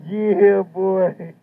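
ordinary talk

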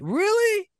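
high, disbelieving Really?; a squeaky, incredulous Really?. Real, not acted. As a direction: shoot up in mock disbelief. Disbelief that he almost cried.

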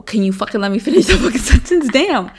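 irritated at partner's interruption; annoyed. Real, not acted. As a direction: demand to finish.

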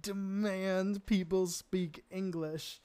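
retold inner dismay. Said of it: "in my head, I'm like".

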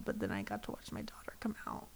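choked-up quiet whisper; tearful whisper. Real, not acted. Wife whispering the quote while still teary. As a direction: barely get the words out through tears. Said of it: "because I cried".

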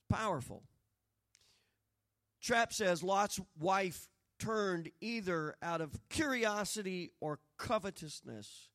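ordinary delivery

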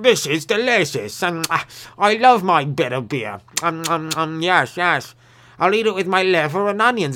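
hammed-up English accent; weird English guy. Weird Englishman praising his bitter beer, hammed-up English accent. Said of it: "that one weird English guy".

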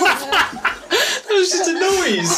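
high laughing voice. Real, not acted. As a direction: crack up laughing. Laughing at failed accent.

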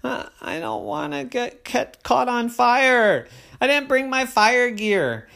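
put-on high anxious child; worried little girl. A narrator's reading. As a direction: fret like a nervous kid. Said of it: "she said".

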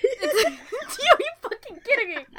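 high laughing disbelief. Real, not acted. Host laughing at cohost who cracked up.

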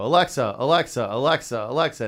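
mock impatient summoning; imitated calling out. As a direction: repeat the name loudly, like a kid hailing a device. Mimics daughter calling the device.

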